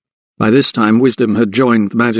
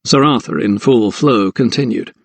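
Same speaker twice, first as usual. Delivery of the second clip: plain reading voice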